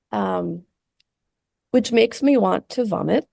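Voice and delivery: ordinary talk, nothing marked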